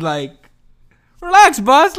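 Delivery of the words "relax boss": high, loud call to calm down; loud, high-pitched 'relax' at the raging teammate; mock scolding. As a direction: shout it at the gamer buddy. Telling a friend to calm down.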